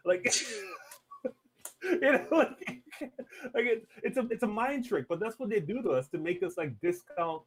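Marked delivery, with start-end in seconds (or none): none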